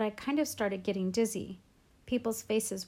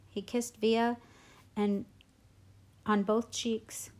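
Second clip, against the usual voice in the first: ordinary delivery, nothing marked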